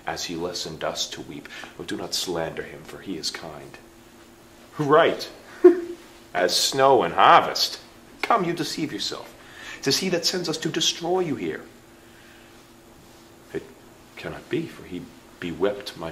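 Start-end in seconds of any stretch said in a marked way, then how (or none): 4.8-7.8 scoffing laugh, then a louder, slow sneer; mocking laugh. Murderer scoffing at Clarence's naive trust, laughing loudly. 8.2-11.6 blunt. Acted. Murderer reveals Gloucester's plot.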